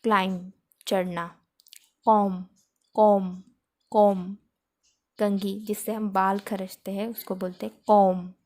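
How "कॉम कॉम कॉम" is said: कॉम said loud, ending on the m with no b; comb with silent b. Shown as a demonstration. Teacher drilling the word comb, loud and clear. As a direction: repeat कॉम clearly, no b at the end. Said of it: "spelling में बी दिखाई दे रहा है, लेकिन".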